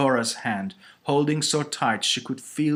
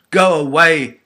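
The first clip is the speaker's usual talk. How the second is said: acted shriek, much louder and higher; screamed; 6 semitones higher, 15 dB louder than usual. A narrator's reading. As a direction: scream it to drive them off. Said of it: "it screamed".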